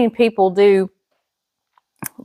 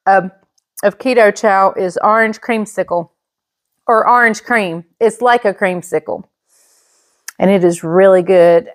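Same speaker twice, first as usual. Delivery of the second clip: usual speaking voice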